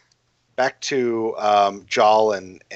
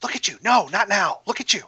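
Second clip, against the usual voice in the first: higher, fussing voice over his wounds; worried scolding; 8 semitones higher than usual. A narrator's reading. Cordelia frets at the wounded player, higher and fussing. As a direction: fret over him like a worried friend.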